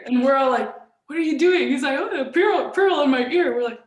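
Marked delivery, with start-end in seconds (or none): 1.1-1.7 higher and louder, playing the team's disbelief; mock shocked question. Teammates at dinner asking their coach what he's doing, in mock shock. Team reacts to coach's pearl. 2.0-3.6 higher, louder, casual coach voice; playing the coach. Coach telling his players there's a pearl in his ear, in a breezy put-on voice.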